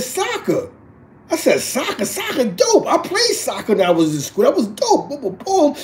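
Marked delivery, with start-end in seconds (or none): 0.0-0.7 very high, little mock girl voice; daughter's voice. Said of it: "She said". 1.6-5.8 high-pitched hyped-up retelling; excited dad. Retold or imitated. Dad enthusing to daughter about soccer.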